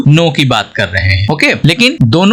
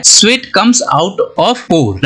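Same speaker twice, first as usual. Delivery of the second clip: very slow, deliberate English sentence; slow example read; much slower than usual. Shown as a demonstration. Teacher reading an example sentence slowly to students. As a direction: read it word by word. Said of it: "तो sentence बताता है".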